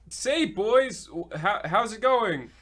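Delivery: a hint of a doddering old woman, halting and unsure; feeble old lady. Old lady asks the boys to help her cross, halting and doddering. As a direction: play a feeble old lady fumbling for words. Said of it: "fine, old woman".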